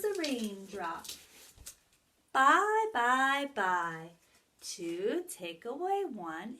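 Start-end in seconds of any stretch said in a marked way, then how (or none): 2.3-4.1 playful goodbye. Acted. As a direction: wave goodbye like a little raindrop. Raindrop waves goodbye.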